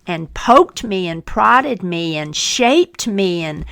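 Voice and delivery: ordinary delivery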